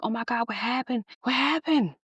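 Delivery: rising alarm, pitch climbing on the repeat; alarmed girlfriend asking what happened; panicked. Retold or imitated. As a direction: retell your panic on hearing him scream. Retold panic on the phone.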